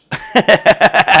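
high burst of laughter; real high-pitched cackling laugh. Real, not acted. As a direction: crack up at your own story. Laughs at his own story.

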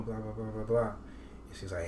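usual speaking voice

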